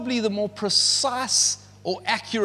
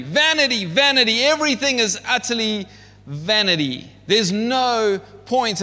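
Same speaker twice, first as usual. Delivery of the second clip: preaching at full force; 5 semitones higher than usual. Acted.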